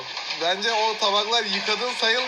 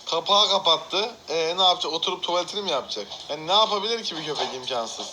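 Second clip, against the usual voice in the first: ordinary delivery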